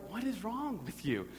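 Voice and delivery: high, baffled mock exasperation; exasperated dad. Father to his crying toddler, mock exasperated.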